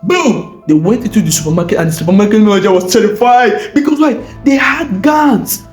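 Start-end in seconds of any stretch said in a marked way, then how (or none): none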